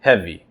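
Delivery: "heavy" with the first syllable hit hard; stress on HE. Shown as a demonstration. Poetry teacher to student, showing the trochee: HEA-vy stressed. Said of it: "is a trochee".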